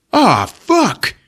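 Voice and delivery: high-pitched mock cursing; exasperated cry. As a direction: blurt it high in alarm. Treat curses at closing doors.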